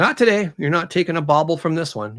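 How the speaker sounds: acted scolding. Woman running at the raven, loud scolding. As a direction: shoo the bird off firmly. She shoos the raven off body.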